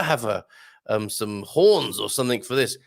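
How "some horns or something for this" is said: usual speaking voice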